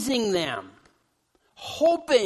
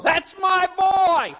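slow, high proud shout; a dad hollering from the pews to his son on stage, proud shout; 9 semitones higher, 6 dB louder, much slower than usual. Retold or imitated. Drawn-out high proud yell. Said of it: "some dad will holler out".

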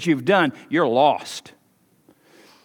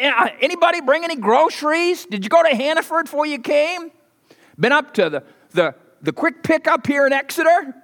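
fussy disciple voice; 11 semitones higher than usual. Acted. Disciples polling the crowd.

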